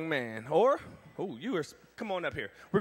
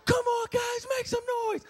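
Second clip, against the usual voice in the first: hype shout. Shown as a demonstration. Strongman showing kids how to hype the crowd, shouting. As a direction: fire up the crowd with a big shout. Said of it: "you look at them and you say".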